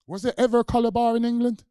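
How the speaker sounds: loud, high rhetorical question; booming high rhetorical question; loud challenge. Real, not acted. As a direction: throw it at the whole room.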